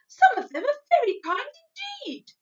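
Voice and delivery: loud, high, indignant retort; angry cry. A narrator's reading. Sophie defending grown-ups to the giant, cross cry. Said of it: "Sophie cried angrily".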